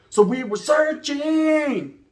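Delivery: very slow, high stretched words; drawn out. Retold or imitated. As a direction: drag the word out. Drawn out searching.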